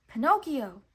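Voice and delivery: loud calling of a name; called out. A narrator's reading. The cricket calling to Pinocchio in the sea, loud call. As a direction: call to him across the water. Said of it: "called the cricket".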